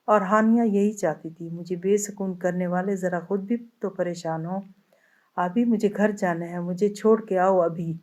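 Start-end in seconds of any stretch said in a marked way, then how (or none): none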